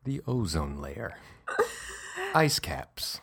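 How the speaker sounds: spooky voice. Pretend. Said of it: "In that voice".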